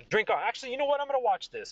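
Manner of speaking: tag omitted. Acted. Barkeep voiced, settling in to watch.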